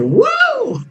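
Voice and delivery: high whooping yell of a rider; excited whoop. Retold or imitated. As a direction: whoop like a thrill-ride passenger. Ride riders yelling past window.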